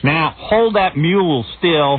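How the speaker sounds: put-on slow hillbilly accent, stretched out; mock him as a hillbilly ploughman; hillbilly farmer. Mocking the hillbilly speaker after clip.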